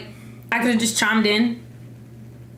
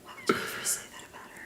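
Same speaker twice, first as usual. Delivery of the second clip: whispered; 15 dB quieter, much faster than usual. Pretend. Mock outrage, now whispered.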